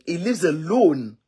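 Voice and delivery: usual speaking voice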